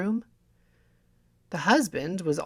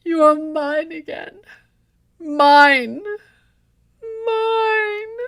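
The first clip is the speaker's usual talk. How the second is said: high, much louder, crazed howl of possession; dying wife's cry; 11 semitones higher, 9 dB louder, much slower than usual. Acted. Poisoned wife clutches her husband, shrieking in triumph. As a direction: scream it out with the poison taking her. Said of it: "she cried".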